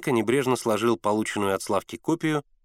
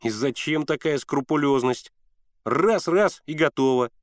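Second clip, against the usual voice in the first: hurrying him along, higher on the quick count; impatient, pitched up toward the end; impatient urging; 3 semitones higher than usual. A narrator's reading. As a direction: urge him to get it over with. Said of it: "всячески торопил Славку".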